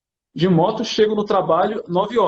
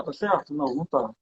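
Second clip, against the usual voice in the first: usual speaking voice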